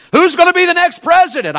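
sudden high, loud question; rising rhetorical cry. Real, not acted. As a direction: fling the question out to the crowd.

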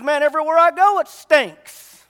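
loud, high complaint that everywhere stinks; a mock loud wail of disgust; exasperated shout. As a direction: belt it out, fed up and baffled. Cheese-nosed man complains loudly.

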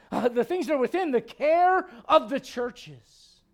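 loud, high, ringing sermon climax; full-force preaching. Acted. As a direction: preach it out at the top of your voice.

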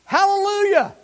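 high, loud joyful cry; loud hallelujah. Acted. Preacher voicing the heavenly multitude, a loud joyful cry. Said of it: "crying out".

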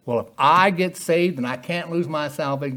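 lightly put-on hillbilly voice; hillbilly accent. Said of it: "I sound like a hillbilly when I do that".

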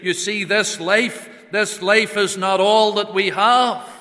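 loud, high, ringing declarations; full-force preaching. Acted. Preacher proclaiming hope beyond the grave, loud. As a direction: hammer home the central truth.

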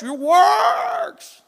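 high, loud, stretched yell on "works"; emphatic drawn-out shout. Acted. Preacher quoting God's statement on works, long rising shout. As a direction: cry it out, rising and held long. Preacher hammers the quoted last word.

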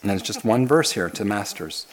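normal talking voice

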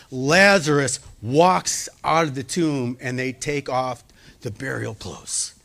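ordinary delivery

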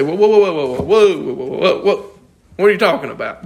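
mock alarm. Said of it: "we say".

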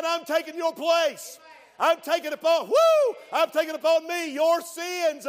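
high, loud preaching shout; shouted voice of Jesus. Acted. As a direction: cry it out as Jesus taking their sins.

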